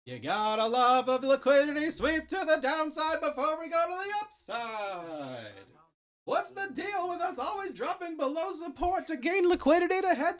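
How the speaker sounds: high, nasal-free New York sitcom whine; loud high New York comedian voice; Seinfeld voice. Pretend. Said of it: "a little less Seinfeldy".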